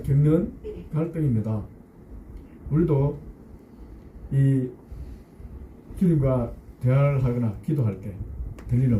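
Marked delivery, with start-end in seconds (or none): none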